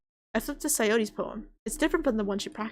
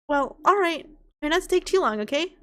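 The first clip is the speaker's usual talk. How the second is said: higher and louder, cheerful club leader; club president voice; 7 semitones higher, 5 dB louder than usual. Acted. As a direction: sound like a chipper club president. Club president voiced, bright reply.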